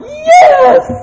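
very high, loud cheer; shrieked jubilant outburst; angels rejoicing. Acted. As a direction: cheer in wild celebration. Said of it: "the angels are like".

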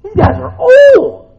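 put-on very high, slow, loud drawn out old; exaggerated amazement. Shown as a demonstration. Preacher repeating for emphasis to the congregation, high slow exaggerated wonder. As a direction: stretch old in mock amazement.

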